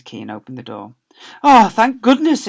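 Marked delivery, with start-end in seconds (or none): 1.4-2.5 loud, high, slowed relief; relieved exclamation. Acted. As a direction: gasp it out, saved at last. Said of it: "exclaimed Roland".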